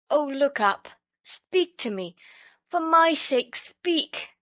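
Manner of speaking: high, loud, urgent pleading; earnest pleading. A narrator's reading. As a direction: beg the fainted boy to look up. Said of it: "said the words over and over again".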